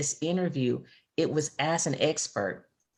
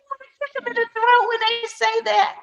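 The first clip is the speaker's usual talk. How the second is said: much higher and louder, fast, laughing as she speaks; laughing outburst; 5 dB louder, much faster than usual. Real, not acted. Joking with a friend about pious comforters, laughing outburst. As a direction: crack up while you say it.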